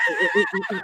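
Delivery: very high laughing stammer; real laughter breaking up words. Real, not acted. Laughing at gossip.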